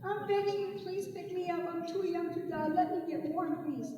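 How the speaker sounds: whimpering plea from a dying creature; begging snake. Acted. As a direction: snake begging the boy to warm it, soft and high.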